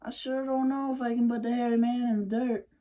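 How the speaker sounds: put-on a worried boy doubting himself; Wiley doubting he can beat the hairy man, high and fast; doubtful young Wiley. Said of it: "I said".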